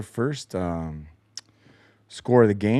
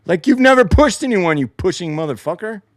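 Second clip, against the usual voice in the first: louder, higher angry yell; angry retort; 8 semitones higher, 8 dB louder than usual. Real, not acted. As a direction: fire it back at the complainer.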